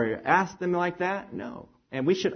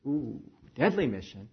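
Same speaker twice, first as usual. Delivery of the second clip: uneasy; 4 semitones lower, 8 dB quieter than usual. Acted. Acted volunteer growing afraid.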